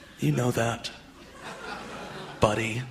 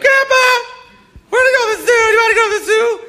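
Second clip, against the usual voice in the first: put-on much higher, louder, eager kid voice; grandson's voice; 4 dB louder, faster than usual. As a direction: squeal it like an excited little boy. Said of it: "My grandson's gonna be like".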